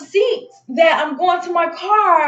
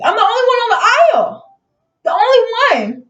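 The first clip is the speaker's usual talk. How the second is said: higher and louder, pressing the point; emphatic insistence; 8 semitones higher, 4 dB louder than usual. Real, not acted. Telling the story, insisting she was the only one. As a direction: hammer home she was alone.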